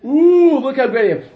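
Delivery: loud high ooh, smug boast; mocking show-off. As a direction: parody a bragging pious man. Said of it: "make an announcement that you're such a tzaddik".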